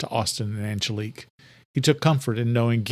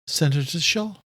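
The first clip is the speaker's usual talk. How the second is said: higher servant; Adela's voice; 6 semitones higher than usual. A narrator's reading. Adela answers.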